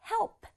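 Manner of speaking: help in one beat with just a small puff on the p; light final P. Shown as a demonstration. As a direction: end help on a released p, no vowel. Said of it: "P의 소리는 파 정도만 나도록".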